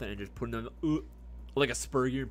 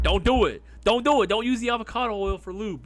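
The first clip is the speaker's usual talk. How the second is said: loud warning; 8 semitones higher, 9 dB louder than usual. Real, not acted. Warning chat off avocado oil.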